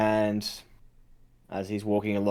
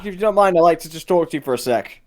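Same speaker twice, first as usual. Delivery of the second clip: raised, higher voice; calling over; 8 semitones higher, 5 dB louder, faster than usual. Acted. Mercenary asking Gadron for a quick word, calling over. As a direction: keep the voice up to reach him.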